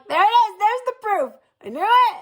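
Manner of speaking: high, excited told-you-so; triumphant gotcha. Retold or imitated. To husband, gleeful high triumph. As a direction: crow that she was right all along.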